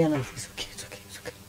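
very soft, voiceless whisper; whispered reassurance. Retold or imitated. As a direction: act out soothing someone under your breath. Retold reassurance after test result.

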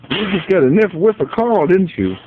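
high, loud excited coo; excited dog-talk. Real, not acted. Owner excites the dog about Carl's smell, high and loud. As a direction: get the dog worked up.

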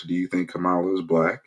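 usual speaking voice

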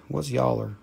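normal talking voice

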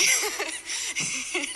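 high, nervous giggle; awkward high laugh after offering prayers to a stranger. Real, not acted. Nervous about asking a stranger.

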